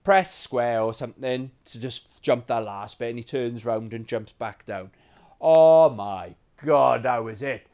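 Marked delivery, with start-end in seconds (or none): 5.4-7.0 exasperated oh my God. Retold or imitated. Retelling game rage moment.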